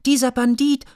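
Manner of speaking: put-on a child's shrill shout; shrill repeated insult. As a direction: top the first call with a high piping shriek. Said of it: "rief Mia Miene auch".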